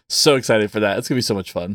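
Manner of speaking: ordinary talk, nothing marked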